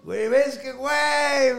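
high, loud excited yell; shouting güey with excitement. Real, not acted. Storyteller yelling at his friend, excited.

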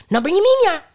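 high, loud mock parent voice; squeaky hammed-up parent refusal; mock Jamaican parent. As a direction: play the stuck-up parent turning them away.

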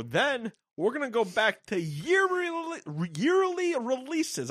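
hammy New York boss, stretching it slow; a botched New York accent; fake New York accent. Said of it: "I can't even do the fucking accent correctly".

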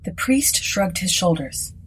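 ordinary delivery

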